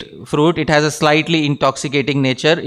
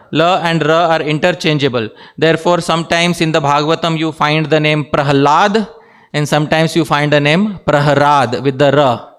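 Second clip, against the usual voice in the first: ordinary talk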